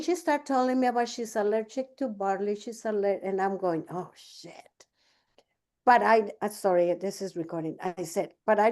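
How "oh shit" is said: hushed whisper under the breath; whispered "oh, shit" aside; whispered curse. Retold or imitated. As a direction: breathe the curse so nobody hears. Aside on hearing barley allergy.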